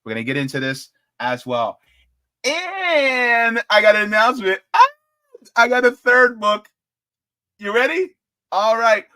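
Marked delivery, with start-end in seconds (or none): 2.4-9.1 high, loud teasing build-up; excited. Real, not acted. Streamer teasing a third book to viewers, excitedly. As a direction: hype the audience for a reveal.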